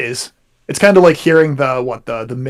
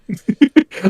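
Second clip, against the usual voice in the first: laugh; 13 semitones higher than usual. Real, not acted. Laugh at the joke.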